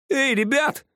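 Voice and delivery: high call to the group. A narrator's reading. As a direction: call the others over, high. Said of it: "вдруг позвал Рыжий". (громкий оклик высоким голосом)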